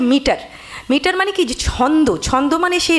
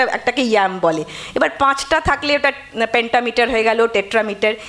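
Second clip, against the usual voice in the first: ordinary talk, nothing marked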